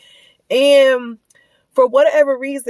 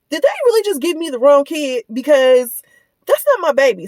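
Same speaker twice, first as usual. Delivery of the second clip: higher, louder incredulous aside; mild raised disbelief; 5 semitones higher, 5 dB louder than usual. Retold or imitated. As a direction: sound stunned at the mix-up. Shocked aside about wrong kid.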